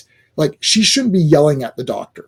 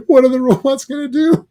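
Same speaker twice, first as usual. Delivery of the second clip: laughing question; 10 semitones higher than usual. Real, not acted.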